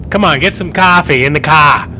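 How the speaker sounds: mock Boston accent. Joking with friends over coffee in the car, mock Boston accent. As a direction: ham up a Boston or New York accent. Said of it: "Is that the Boston accent?".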